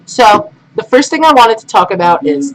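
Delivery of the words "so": ordinary delivery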